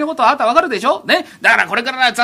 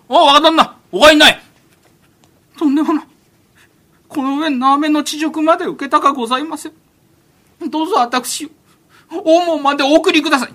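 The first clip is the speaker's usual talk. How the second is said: put-on higher, earnest young master begging to leave; a sheltered heir asking politely but firmly; prim young master; 6 semitones higher, 6 dB louder than usual. Young master insists the men escort him home.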